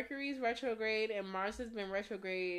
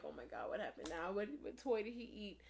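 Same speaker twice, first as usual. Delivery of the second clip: worried; 8 dB quieter than usual. Retold or imitated. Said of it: "and I'm like".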